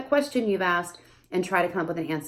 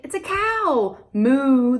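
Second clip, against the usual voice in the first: high voice then mooing; high bright label with a moo; parentese labeling; 5 semitones higher, 5 dB louder, much slower than usual. Shown as a demonstration. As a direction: teach the toddler the animal. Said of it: "So you say".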